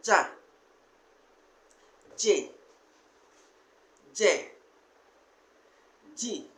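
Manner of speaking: each syllable said slowly and separately; syllable drill. Shown as a demonstration. Teacher drilling J syllables for the class, each said separately. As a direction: model the J syllables one at a time for students. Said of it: "let's move to the next group".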